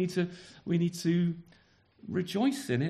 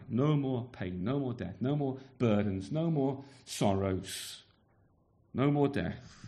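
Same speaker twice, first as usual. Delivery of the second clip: solemn litany; 8 semitones lower than usual. Acted. Preacher listing with repetition.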